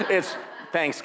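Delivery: talking through a mouthful; words round a bite of pancake; mouth full. Real, not acted. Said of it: "rude to talk with your mouth full".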